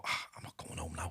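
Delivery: low, hushed mutter under the breath; a hushed resigned grumble, pitch dropped; muttered to self. Retold or imitated. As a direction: grumble it quietly to yourself, low. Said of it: "I go".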